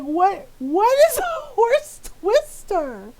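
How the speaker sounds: loud, high, drawn-out bewilderment; baffled disbelief. Retold or imitated. Adult child questioning mom's horse twister, loud bewildered disbelief.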